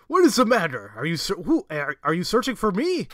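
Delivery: a hint of an anime character; lightly voiced character. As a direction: read the character's line with a bit of flair. Voicing a character's lines.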